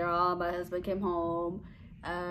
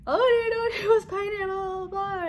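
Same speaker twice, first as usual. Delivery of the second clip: very high, loud babbling mock shock; hammed-up squeal of a stunned husband; imitated shock; 14 semitones higher, 7 dB louder than usual. Husband reacting to pregnancy news, in imitated shock. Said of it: "So he was so shocked".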